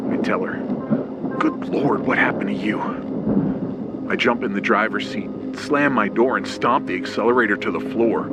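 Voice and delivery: plain reading voice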